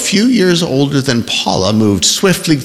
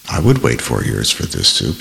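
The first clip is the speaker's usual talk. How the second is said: blissful; 10 semitones lower, 4 dB quieter than usual. A narrator's reading. Savoring soup, eyes closed.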